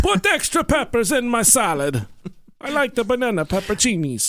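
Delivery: high cartoon Italian accent; jolly italian pizza chef. Italian voice continues.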